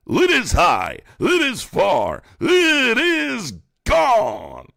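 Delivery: builds from high and loud to a long, soaring "gone"; mock home-run call. Mocking Trump supporters with a soaring home-run call. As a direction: stretch the last word like a stadium announcer.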